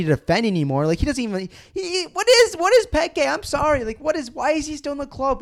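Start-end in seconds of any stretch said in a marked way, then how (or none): 1.4-5.4 much higher pitched, disbelieving rant; incredulous outburst. Real, not acted. Fan ranting about a player to listeners, high incredulous squeal. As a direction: squeal it like you can't believe he still plays.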